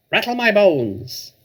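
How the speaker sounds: high, slow, eerie parrot croak; spooky parrot voice. Acted. Parrot showing off its spooky voice, high and slow. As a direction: stretch it out like a haunted bird. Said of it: "a wonderful imitation of a spooky voice".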